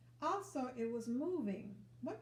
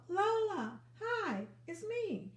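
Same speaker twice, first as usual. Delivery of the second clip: high, loud happy call down from a branch; high, loud, beaming call; 9 semitones higher, 5 dB louder, slower than usual. Acted. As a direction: shout down to a friend with joy.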